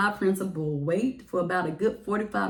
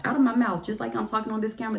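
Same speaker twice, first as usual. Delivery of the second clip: ordinary talk, nothing marked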